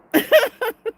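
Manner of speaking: loud high burst of laughter; high cackle. Real, not acted. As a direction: cackle at yourself.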